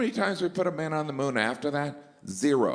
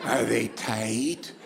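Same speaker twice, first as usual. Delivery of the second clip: creepy salesman; 4 semitones lower, much slower than usual. Pretend.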